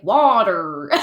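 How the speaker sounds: friend imitating an American saying "Water"; "Water" with a hard American R; mock American accent. Australian friend mocking Americans, saying "Water" in a fake American accent. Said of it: "she said water".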